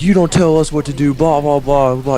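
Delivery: high mocking imitation of a rant; retold protest trailing into blahs; mock arguing. Retold argument, mimicking Fletcher.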